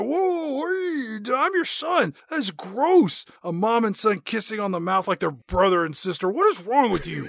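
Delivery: high, hammed-up disgusted teen boy; mock grossed-out son. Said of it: "he's like".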